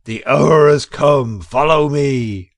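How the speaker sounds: loud, slow battle call; rally them through the door; cry out. A narrator's reading. Badger leading the charge, a loud slow cry. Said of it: "and cried".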